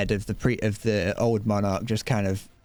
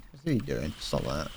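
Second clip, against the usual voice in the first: muttered aside; 8 semitones lower, 6 dB quieter than usual. Real, not acted. Muttering at enemy in game.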